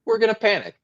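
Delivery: high, excited burst of alarm; mock panic. Real, not acted. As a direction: pretend to panic, pitch jumping up. Joking contrast with not panic.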